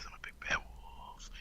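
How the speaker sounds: sexy whisper. Pretend. Whispered big bad wolf bit.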